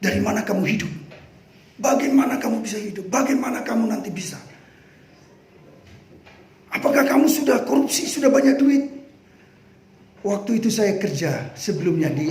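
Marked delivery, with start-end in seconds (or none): 0.0-8.8 mocking brother. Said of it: "abang saya bilang".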